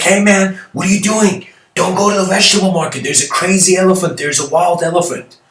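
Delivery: high, loud and rushed, as if shouting a warning; alarmed warning. Retold or imitated. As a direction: run up breathless and panicky. Man running up with a warning.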